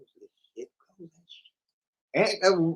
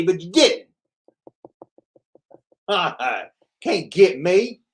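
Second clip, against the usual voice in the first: normal talking voice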